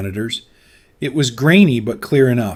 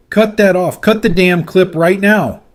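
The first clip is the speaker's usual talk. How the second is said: furious shout at the control room; 7 semitones higher, 7 dB louder than usual. A narrator's reading. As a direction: yell it out, full force. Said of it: "he shouted".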